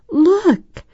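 put-on high, loud little-girl squeal; thrilled, high-pitched exclamation; delighted squeal. A narrator's reading. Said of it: "Desiree squealed with delight".

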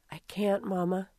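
a soft, weary groan of a reply; groaning softly. Acted. Maria refusing to get up for Mama, soft weary groan. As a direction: barely get it out, too tired to move. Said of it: "Maria groaned".

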